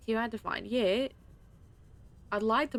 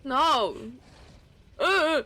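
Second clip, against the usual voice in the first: alarmed shout; 6 semitones higher, 10 dB louder than usual. Real, not acted. Sudden danger.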